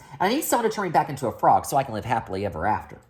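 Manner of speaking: rattled off very fast; in one long breath. Acted. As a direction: rush it all in one breath. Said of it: "in one long breath".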